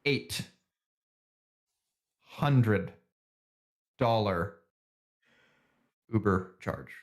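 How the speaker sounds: "Eight", "hundred", "dollar" each set apart by long pauses; emphatic word-by-word answer. Real, not acted. Correcting co-host on the amount, "Eight hundred dollar" spaced out emphatically. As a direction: separate "Eight" and "hundred" with weighty pauses, then "dollar Uber charge".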